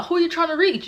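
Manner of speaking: high-pitched put-on phone politeness. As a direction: imitate a helpful stranger on the phone.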